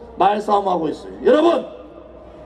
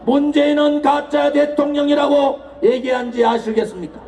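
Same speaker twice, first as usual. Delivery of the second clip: roaring rallying speech; 4 semitones higher than usual. Acted. Political rally oration.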